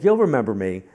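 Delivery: loud, confident insistence; insistent assertion. Retold or imitated. Woman to the reader who forgot her, insistent. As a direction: push it out loudly as a sure statement.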